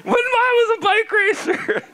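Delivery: put-on high, straining old-man rant; ranting granddad. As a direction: lecture the youngsters in a cranky high voice. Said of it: "I feel like your granddad".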